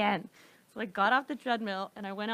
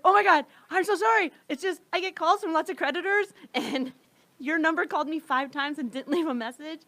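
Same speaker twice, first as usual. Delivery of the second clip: flustered apology; 6 semitones higher, 5 dB louder than usual. Retold or imitated. Apologizing to the focus group woman.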